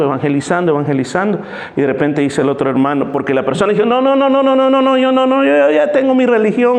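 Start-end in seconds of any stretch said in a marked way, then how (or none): 3.9-6.8 agitated refusal. Acted. Person refusing the gospel, agitated.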